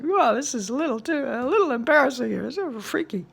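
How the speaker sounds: high, sheepish, bewildered voice; embarrassed teen. Retold or imitated. Teenager embarrassed at crying in a session, high sheepish voice. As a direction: sound awkward and freaked out. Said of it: "it's like".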